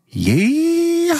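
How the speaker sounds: very high, long drawn-out yeehaw whoop; gleeful hammed-up cowboy holler. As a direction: hold the yeehaw in a piercing high wail. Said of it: "only thing he says is".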